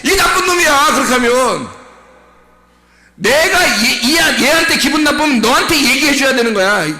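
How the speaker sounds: loud, high-pitched preacher's tirade; full-force preaching. Acted. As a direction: raise the voice high and scold the congregation. (목청 높여 꾸짖는 설교)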